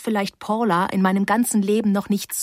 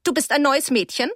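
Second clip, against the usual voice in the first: frosty, higher-pitched question; 5 semitones higher than usual. A narrator's reading. Teacher questioning the new girl, frosty. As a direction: ask it with cool disdain. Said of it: "sagte Miss Becks frostig".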